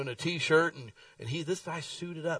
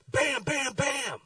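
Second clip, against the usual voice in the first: door knocking; 13 semitones higher, 3 dB louder, much slower than usual. Retold or imitated. Imitating hard door knocks.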